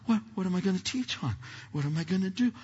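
ordinary talk

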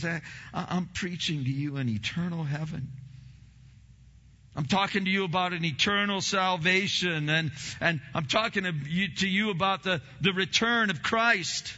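high, loud voicing of Paul proclaiming; Paul preaching. Pastor voicing Paul to his church, fervent loud preaching. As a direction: hammer home Paul's sermon as your own. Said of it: "He says".

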